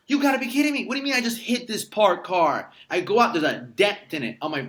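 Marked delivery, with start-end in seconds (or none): 0.1-2.7 high, loud exasperated disbelief. Retold or imitated. After hitting a parked car, high loud disbelief. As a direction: blurt it out, can't believe it. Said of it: "And I'm like".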